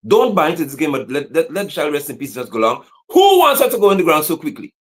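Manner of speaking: ordinary talk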